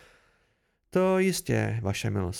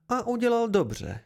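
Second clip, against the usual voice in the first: heated noblewoman, voice jumping higher; vehement outburst; 7 semitones higher than usual. A narrator's reading. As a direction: blurt it out sharply, high-pitched. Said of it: "vyhrkla Meluan prudce". (prudce vyhrknout, vysokým hlasem)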